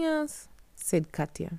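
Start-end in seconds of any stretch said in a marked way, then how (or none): 0.0-0.5 put-on high girl voice. Katya answers.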